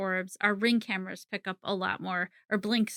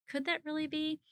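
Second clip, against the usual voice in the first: mild disbelief; 7 semitones higher, 6 dB quieter than usual. Acted. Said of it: "have the disbelief of it".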